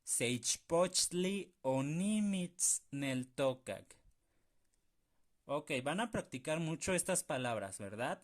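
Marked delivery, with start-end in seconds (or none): 0.0-4.0 "Seichpochtli onimitzneltokak" muy despacio; lento y claro. Shown as a demonstration. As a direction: articula cada sílaba. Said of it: "palabras complicadas". (verso lento y marcado)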